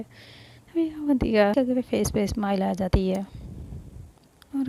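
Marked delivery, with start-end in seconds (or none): none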